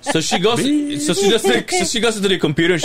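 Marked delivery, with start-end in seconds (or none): none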